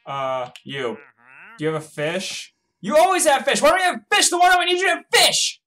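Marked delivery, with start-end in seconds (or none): 2.8-5.6 high, loud outraged yell; exasperated screaming. Real, not acted. Player berating someone who has no fish, exasperated screaming. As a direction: screech the complaint in total exasperation.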